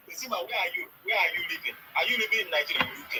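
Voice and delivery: loud, pressing questions; loud demand. Real, not acted. In a heated debate, loudly pressing Sima where she lives. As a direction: call out the questions hard.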